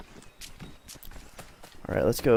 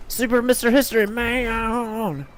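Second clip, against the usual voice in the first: high mock hero announcer; 8 semitones higher than usual. Hero persona, pitched up.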